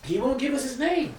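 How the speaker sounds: usual speaking voice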